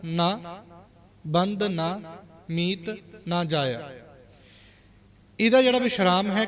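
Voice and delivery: usual speaking voice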